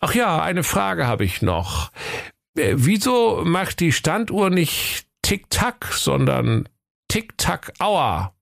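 slow, high, drawn-out afterthought; comic detective turning back; musing inspector. A narrator's reading. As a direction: pose one last puzzled question slowly. Inspector's last question on leaving.

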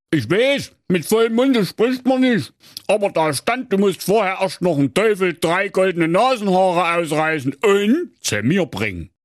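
high, squawky scolding king. A narrator's reading. King snapping at Schnitzelschorsch in a high scolding voice. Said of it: "Und er sprach".

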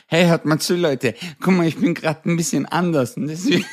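giggling fake-earnest plea; laughing through it. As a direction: tell it while cracking up. Stoned explanation, laughing throughout.

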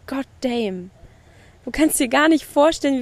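usual speaking voice

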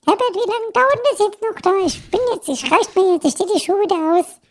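high falsetto fed-up wife calling from afar; nagging wife voice. Acted. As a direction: play the wife losing patience, calling out.

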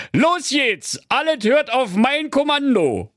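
high, energetic donkey bandleader; rally the troops as the donkey in charge; bossy donkey, rallying. Acted. Donkey rallies the band. (Esel als Anführer, hoch und schwungvoll)

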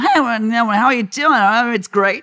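mock an over-eager American actor; loud cheesy Hollywood-style English. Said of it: "Also wenn die so".

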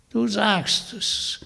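put-on slow, high sly old crone; sly witch. Witch smugly confirming to Vanya. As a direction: drawl it out as a smug hag.

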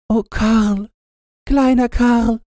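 high, yearning sigh of a name; sighing girl. A narrator's reading. Gretchen sighs for little Karl, high yearning voice. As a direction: sigh for the lost boy. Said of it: "seufzte Gretchen".

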